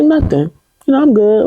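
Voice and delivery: high, breezy mock "I'm fine"; brushing it off. Brushing off First Lady's concern.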